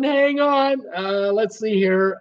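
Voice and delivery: ordinary delivery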